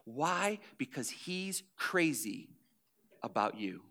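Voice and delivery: tender appeal. Acted. Pastor inviting the hurting to let go, soft and tender. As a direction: draw them in gently, almost confiding.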